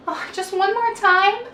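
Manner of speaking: put-on high, pleading little boy; child voice. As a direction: beg like a small boy. Walter pleading with father.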